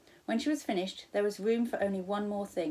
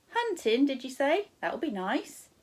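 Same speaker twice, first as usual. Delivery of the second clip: high, louder friendly stall lady; cheery shop woman; 7 semitones higher, 6 dB louder than usual. Acted. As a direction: sing out the wares like a cheery server. Counter woman mishears.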